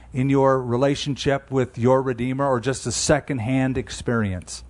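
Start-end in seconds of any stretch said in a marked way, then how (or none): none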